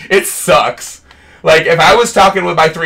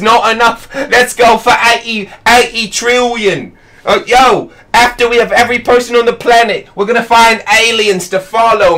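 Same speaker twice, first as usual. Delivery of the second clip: bad British impression; 6 semitones higher than usual. Streamer parodying a YouTuber's wack British impression, hammed-up. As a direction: overblown fake British YouTuber begging for subscribers. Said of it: "bad British impression".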